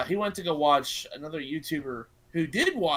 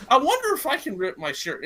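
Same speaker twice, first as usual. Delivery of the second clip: lightly acted eager wondering, pitched up; playful quote of someone getting an idea; imitating Etika; 6 semitones higher, 5 dB louder than usual. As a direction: retell a bold idea, a bit louder and higher. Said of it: "And then Etika's just like".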